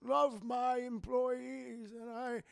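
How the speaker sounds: put-on slow, soft, gentle old boss voice; imitating a kindly old boss hiding his evil; kindly old man. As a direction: coo it like a sweet old company man. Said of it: "he's this kindly old man".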